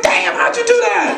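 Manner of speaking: high-pitched astonished question; mock amazement. Dad to daughter after her trick, mock amazement.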